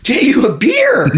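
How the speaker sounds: high, baffled bartender. Acted. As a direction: stammer along like a confused barman. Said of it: "The bar's like".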